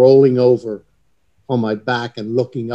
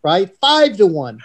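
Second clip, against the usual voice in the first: excited bargaining; 9 semitones higher, 4 dB louder than usual. Retold or imitated. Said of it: "these two guys go".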